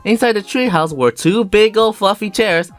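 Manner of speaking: usual speaking voice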